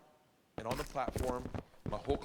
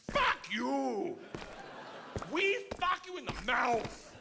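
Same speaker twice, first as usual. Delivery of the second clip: Russian gangster yelling; 10 semitones higher, slower than usual. Retold or imitated. Igor yelling at the cop.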